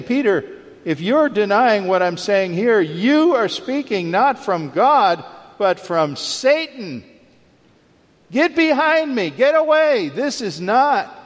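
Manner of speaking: forceful, high-pitched reprimand; acted-out stern rebuke. Jesus rebuking Peter for denying him, acted-out stern rebuke. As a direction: drive each phrase home, climbing to a high command. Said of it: "in the strongest possible terms".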